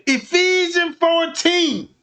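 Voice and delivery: a hint of preacher delivery; mock preacher. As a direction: proclaim it like from the pulpit. Mock sermon citation.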